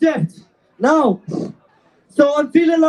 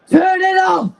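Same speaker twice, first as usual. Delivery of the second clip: rallying call, pitched up; shouting; 5 semitones higher than usual. Real, not acted. Rally crowd chant, calling out.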